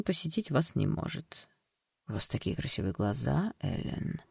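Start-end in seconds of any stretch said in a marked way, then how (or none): 2.1-4.2 low, soft eerie whisper. Acted. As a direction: breathe the compliment softly. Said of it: "прошептал".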